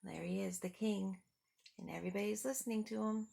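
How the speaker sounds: ordinary delivery, nothing marked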